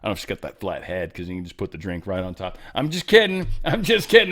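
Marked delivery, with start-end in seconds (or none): 2.8-4.3 pitched up higher, playful and a bit sheepish; jokey take-back. Real, not acted. Host taking back a cheeky joke to viewers, higher playful walk-back. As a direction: laugh off your own bad joke, voice jumping up. Joking retraction after a flat-head joke.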